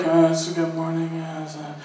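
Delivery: low, quiet mumbled reply; tired mumble. Retold or imitated. Tired stranger answers a morning greeting, low mumble. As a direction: trail off, tired and barely audible.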